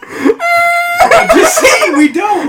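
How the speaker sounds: high, loud, squealing through laughter; helpless laughing protest; laughing hard. Real, not acted. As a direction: force it out while cracking up. Defending joke while cracking up.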